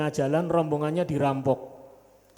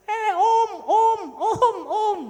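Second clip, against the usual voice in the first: suara anak kecil tinggi, memanggil dari jauh; much slower than usual. Retold or imitated. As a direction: teriak kecil mengejar mobil. (anak kecil memanggil turis dengan suara tinggi)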